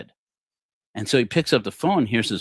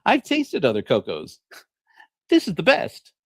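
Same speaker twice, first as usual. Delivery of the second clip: higher, bright sales pitch; ad-pitch wife; 8 semitones higher, 4 dB louder than usual. Acted. Wife keeps up the product pitch, mock ad voice. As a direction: sell it like a commercial. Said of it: "She says".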